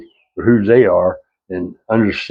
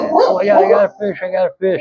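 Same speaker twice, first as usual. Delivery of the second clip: high-pitched mock excitement over a catch; excited yelling; 11 semitones higher than usual. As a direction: imitate a woman squealing over her first fish. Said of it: "start screaming and yelling and getting all excited".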